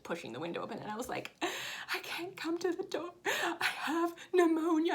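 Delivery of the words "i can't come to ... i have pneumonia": high, halting, hammed-up feeble plea; mock-pitiful and overdramatic, dragging out the words; dramatic invalid. Sick mom turning carolers away from her window, hammed-up dramatic. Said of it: "And it was so dramatic".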